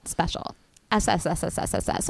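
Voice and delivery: Special with a long hissed S; hissing S. Shown as a demonstration. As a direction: hiss out each S. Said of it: "All my hard letters".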